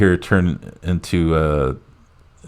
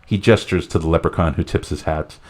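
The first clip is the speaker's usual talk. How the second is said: ordinary talk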